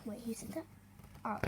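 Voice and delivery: ordinary delivery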